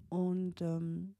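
ordinary talk

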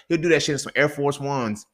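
ordinary talk

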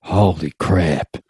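low, slow drawn-out gasp; Wade Boggs. Retold or imitated. Wade Boggs sees the gold, low slow awe. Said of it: "Wade Boggs is like".

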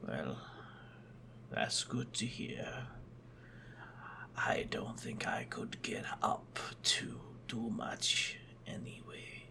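weak, low, breathy sickbed voice; barely able to speak from bed; frail sick man. Acted. Bedridden sick uncle speaks.

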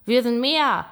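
loud, high single exclamation; rallying call. A narrator's reading. Fox rallies the frightened animals, loud call. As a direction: speak up to rally everyone.

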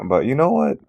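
usual speaking voice

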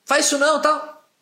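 high, loud, fast outraged yell; angry stranger confronting a dog owner, loud and high. Retold or imitated. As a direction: call out a stranger for scolding his dog. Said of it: "o cara fica louco".

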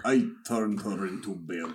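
low-key mock Russian accent. Echoing the friend's villain joke, mock Russian accent. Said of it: "when you do a Russian accent".

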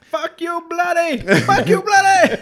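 high, loud put-on squawk; jokey high-pitched yelling, breaking into laughter; mock insult voice. Friend riffs on the road argument, high mock-angry voice.

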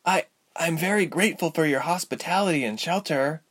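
put-on high girlish voice, hesitant start; murmuring young Ilse. A narrator's reading. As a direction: stammer slightly, then thank politely. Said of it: "Ilse murmured".

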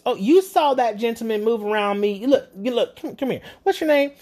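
high, insistent put-on voice; mock pushy old lady. Imitating the lady demanding the narrator's name, high and pushy. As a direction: ham up a pushy customer, pitched high.

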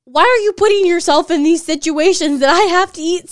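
loudly exasperated retold reply; exasperated outburst. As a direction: protest it in disbelief. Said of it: "I'm just like".

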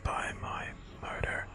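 soft, slow spectral whisper; ghostly whisper. Acted. Ghost saying farewell to the boy, soft spectral whisper. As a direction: breathe it like a fading ghost.

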